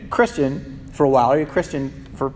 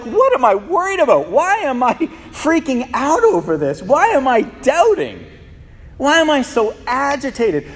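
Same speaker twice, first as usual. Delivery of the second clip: higher, fretful string of questions; anxious self-questioning; 7 semitones higher than usual. Acted. Preacher voicing his own worried doubts, higher and fretful.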